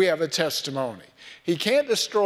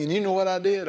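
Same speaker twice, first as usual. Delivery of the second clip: ordinary delivery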